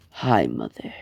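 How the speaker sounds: son's icy low greeting to his mother on the phone; cold low greeting. Acted. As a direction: drop the voice into a sinister calm. Villain Deku greets his neglectful mother.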